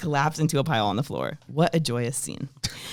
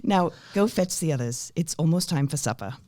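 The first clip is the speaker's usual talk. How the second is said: put-on British accent; 3 semitones higher than usual. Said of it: "the accent I'm going to do".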